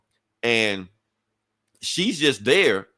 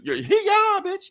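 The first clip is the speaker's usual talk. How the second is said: high squeaky mocking taunt; slower than usual. Reader voicing the villain kicking Iron Man, high mock taunt. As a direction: squeak it out like a cartoon bully.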